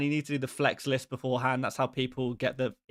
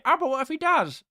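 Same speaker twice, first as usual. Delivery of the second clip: high, whiny pestering viewer; mock the nagging chat, pitched up; a whiny viewer begging, pitched high; annoying chat voice; 12 semitones higher, 5 dB louder than usual. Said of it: "That is the annoying voice".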